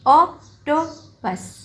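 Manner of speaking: ऑक्टोपस slowly split ऑक, टो, पस; syllable by syllable. Shown as a demonstration. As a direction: pause between each piece of ऑक्टोपस. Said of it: "दरमियान में dots लगाने हैं".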